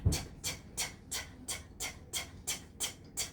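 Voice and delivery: whispered t t t like rotor blades; helicopter t's. Shown as a demonstration. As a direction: tick out the helicopter blades softly. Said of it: "turn in a circle and say".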